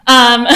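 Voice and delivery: shaky nervous giggle; nervous laugh. Real, not acted. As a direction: laugh off the nerves. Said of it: "I'm nervous".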